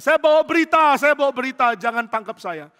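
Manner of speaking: loud high plea, fading down; urgent messenger. Acted. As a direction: shout to the guards not to seize you. Breathless messenger pleading at gate. (utusan berteriak memohon, makin pelan)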